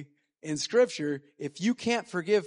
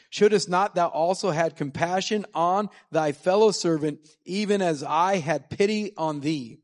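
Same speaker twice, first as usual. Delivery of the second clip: louder, measured King James reading; scripture reading; 5 dB louder than usual. Acted. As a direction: read it like solemn scripture from the pulpit. Reads rest of the parable.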